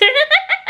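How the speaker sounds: short high-pitched laugh; high burst of laughter. Real, not acted. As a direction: laugh up high at your own joke. Laughing at mega pint.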